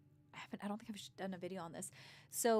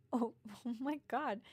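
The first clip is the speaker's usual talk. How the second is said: laughing; 5 semitones higher, 4 dB louder than usual. Retold or imitated. Laughing reaction to child.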